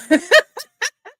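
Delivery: a loud, high burst of laughter. Real, not acted. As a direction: crack up at the comment. Laughs at comment.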